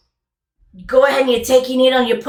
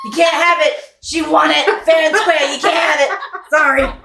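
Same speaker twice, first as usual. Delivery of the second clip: louder and higher, gleefully rubbing it in; taunting shout; 6 semitones higher, 5 dB louder than usual. Acted. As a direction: tease them, loud and high. Jack taunting a player loudly.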